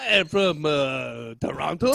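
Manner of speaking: put-on lightly French-accented reply; a hint of French accent. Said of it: "my bad French accent".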